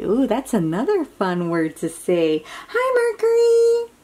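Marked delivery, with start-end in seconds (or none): 2.7-3.9 high, bright hello to the planet; cheery greeting. Acted. Child's reading, high cheery hello to Mercury.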